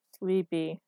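drowsy little one-word complaint; sleepy. A narrator's reading. Woman answers from under the comforter, sleepy. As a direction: say it half asleep.

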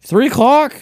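high, loud, disbelieving question; incredulous. Real, not acted. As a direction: repeat it in startled disbelief. Taken aback by the showtime.

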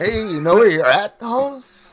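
high, loud put-on street challenge; mock tough guy. Acting a threat to the co-host, loud mock tough-guy voice. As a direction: challenge him in a hammed-up homeboy voice.

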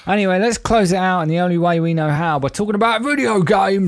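big rising announcer build. Acted. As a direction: hype it like a show host. Dramatic segment lead-in.

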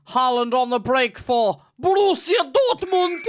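high, loud over-the-top match commentary; hammed-up commentator shout; mock BT Sport commentator. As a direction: imitate an excitable football commentator calling a breakaway. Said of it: "You have the B T Sport guy".

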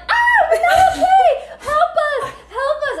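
very high, loud mock-panic shriek; screaming for help. Acting out screaming down the phone to Mike, laughing shriek. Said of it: "And we're just screaming".

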